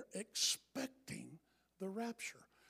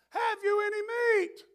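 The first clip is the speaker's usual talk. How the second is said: high shouted call from the clouds; God yelling down; 12 semitones higher, 6 dB louder than usual. Retold or imitated. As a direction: bellow it down from above. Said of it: "yell down".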